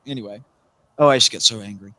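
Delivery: usual speaking voice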